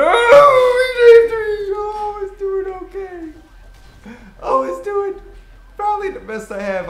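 high, slow fake sob; mock crying. Gamer fake-sobbing after getting killed. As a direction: whimper it like a hammed-up cry.

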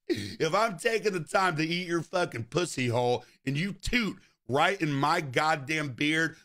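ordinary delivery

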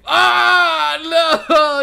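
high, loud cry of dismay; horrified outburst. Real, not acted. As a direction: scream out your protest. Horrified by creepy narration.

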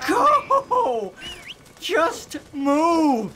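high, frantic shouts with laughter mixed in; frantic yelling. Real, not acted. Gamer yelling at stalled kids, frantic and half-laughing.

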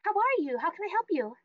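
high, lilting welcome to a customer; perky greeter. Acted. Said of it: "she kinda perks up and is like".

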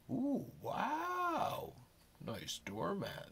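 mock awe, softly voiced admiration; impressed cooing. Imagined visitor admiring a doormat.

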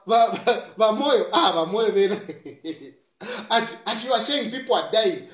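ordinary delivery

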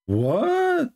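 a high incredulous What?; squeaked mock astonishment; mock shock. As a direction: play it as fake disbelief. Feigned shock at opposite reading.

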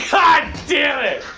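loud curse through laughter, drawn out slowly; laughing curse. Real, not acted. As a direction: yell it while cracking up. Laughing after being killed.

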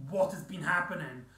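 ordinary talk, nothing marked

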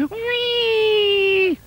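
high, long sliding squeal; sliding whee. Acted. Duck going down the beanstalk slide, squealing.

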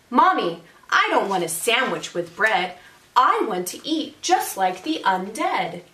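lively, singsong storytelling; picture-book read-aloud. A narrator's reading. Teacher reads a zombie picture book to families, animated read-aloud. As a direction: read it to children with rhythmic emphasis. Reads dialogue of boy and mother.

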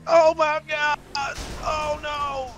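high wail of alarm; loud, high-pitched alarm; panicked cry. Pretend. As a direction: wail in panic as it all goes wrong. Redneck panics as vehicle crashes.

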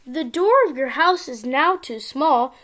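plain reading voice

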